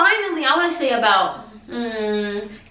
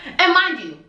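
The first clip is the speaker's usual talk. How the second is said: normal talking voice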